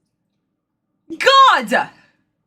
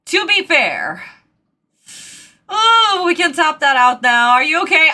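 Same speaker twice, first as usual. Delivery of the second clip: normal talking voice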